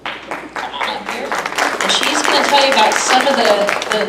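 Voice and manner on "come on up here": ordinary talk, nothing marked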